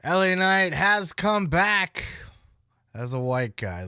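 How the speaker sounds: a hint of a grumpy old wrestler; mimicking Nash. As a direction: drag it out like Nash did. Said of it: "He literally said".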